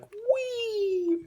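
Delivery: high squeaky whee of a jump; playful whee. Pretend. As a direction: squeal it like a leaping toy. Toy frog jumping, playful cry.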